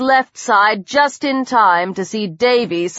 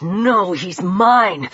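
snarled claim, held in; possessive snarl; 4 semitones lower, much slower than usual. A narrator's reading. Peter warns Davy off Lang, snarling. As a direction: growl to claim the prey. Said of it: "formed itself in a snarl".

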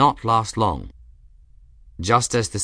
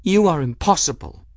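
exasperated amusement; 7 semitones higher, 3 dB louder than usual. A narrator's reading. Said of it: "She choked out a laugh".

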